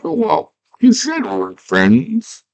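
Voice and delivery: slow, high, meek cartoon voice; hammed-up timid reminder, pitched high; wounded protest. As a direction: plead as a hurt little coward. Hoggle wounded by Jareth's taunt.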